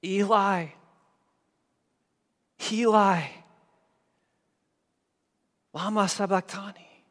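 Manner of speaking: high, anguished cry to heaven. Acted. Christ on the cross crying out in desperation. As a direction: let it ring out like a desperate cry. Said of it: "cried out with a loud voice".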